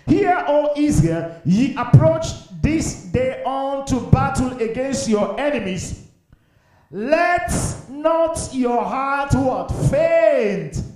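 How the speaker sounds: high, slow, forceful declamation; ringing, drawn-out preacher's call; priestly proclamation. Acted. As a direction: stretch the verse out to a whole crowd. Preaches the verse at full force.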